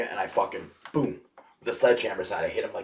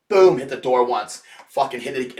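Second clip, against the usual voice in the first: loud emphatic; 5 semitones higher, 12 dB louder than usual. Retold or imitated. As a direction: hit each word hard. Smashing door with hammer.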